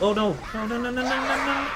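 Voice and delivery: rising rapid-fire cry of dismay; real gamer panic. Real, not acted. Gamer reacting to trouble in the game, panicked.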